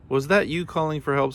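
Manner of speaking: high, indignant mild cry; screamed. A narrator's reading. Soaked Fish confronts Kangaroo, mild high screamed question. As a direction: demand it high, without full volume. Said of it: "screamed Fish".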